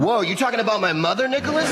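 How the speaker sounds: high, fast mock-offended challenge; mock outrage. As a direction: jump in as if your mother was insulted. Mock offence at remark on mother.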